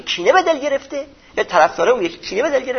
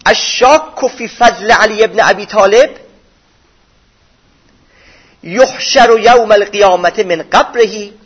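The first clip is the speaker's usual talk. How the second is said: slow, louder, measured Arabic recitation; hadith recitation; 5 dB louder, slower than usual. Acted. Preacher reciting the hadith to listeners, slow and intoned. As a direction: intone the hadith slowly and firmly.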